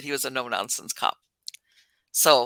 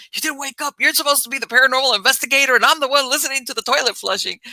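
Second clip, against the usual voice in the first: mock upset boyfriend; 7 semitones higher, faster than usual. Boyfriend upset quote retold.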